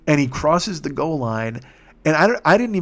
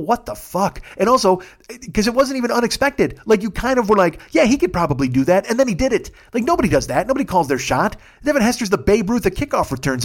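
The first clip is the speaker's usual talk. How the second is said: high, loud excited disbelief; 8 semitones higher, 3 dB louder than usual. Real, not acted. Telling listeners about the Super Bowl, high excited raving. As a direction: rave about it, buzzing.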